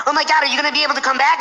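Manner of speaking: high, rapid mock panic; friend freaking out. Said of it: "he's, like, freaking out".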